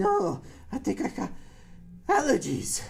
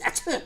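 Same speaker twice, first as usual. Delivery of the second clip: sneeze; 10 semitones higher than usual. Pretend. Dad pretends to keep sneezing.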